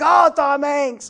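high, loud, drawn-out recognition; imitating a fan thrilled at a star; mock starstruck gasp. Fan seeing Tom Hanks walk through the door, mock starstruck.

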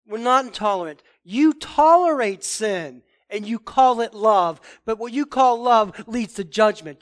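loud and slow, bold and unbending; preacher models a loud rebuke to critics; firm loud rebuke. Shown as a demonstration. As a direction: declare it like a prophet facing sin. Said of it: "We say".

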